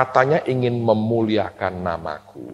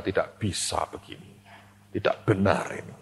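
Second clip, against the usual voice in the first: quiet complaint; 6 semitones lower, 12 dB quieter than usual. Retold or imitated. Pastor quietly protesting, heart hot.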